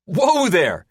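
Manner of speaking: high, loud startled shout. Acted. Gunner shouts as something streaks past, startled. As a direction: yell as the jet jerks. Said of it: "Athos shouts".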